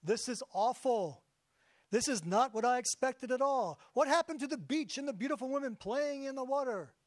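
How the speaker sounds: hammed-up despairing wail; anguish and despair. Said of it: "Bill responded with anguish and despair".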